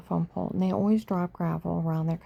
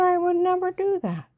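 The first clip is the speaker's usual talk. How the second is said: indignant protest; 13 semitones higher, 4 dB louder than usual. Retold or imitated. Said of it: "I said".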